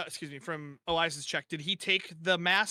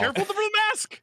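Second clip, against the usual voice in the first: high, loud cry of caution; urgent warning; 13 semitones higher, 5 dB louder than usual. Acted. Player warns the others about the mask, urgent warning. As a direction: call out a high-pitched, louder warning.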